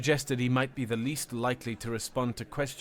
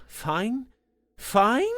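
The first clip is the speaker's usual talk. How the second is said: fine? climbing higher and louder; incredulous rising fine; voice rising in disbelief; 9 semitones higher, 6 dB louder than usual. A narrator's reading. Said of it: "His voice rose half an octave".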